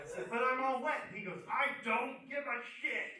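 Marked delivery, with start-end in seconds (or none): 0.3-1.1 loud, high-pitched objection; raised-voice mock complaint; protesting. Protesting to the bullying host. 1.5-3.1 high, loud, drawn-out retort; mock-angry brush-off; angry dismissal. Host brushes off the protest.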